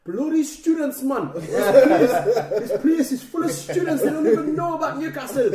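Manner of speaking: loud, high, laughing Geordie rant; mock Geordie fans. Angry Newcastle fans heard from the toilet, mock Geordie shouting. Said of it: "proper Newcastle fans going".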